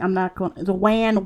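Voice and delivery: ordinary talk, nothing marked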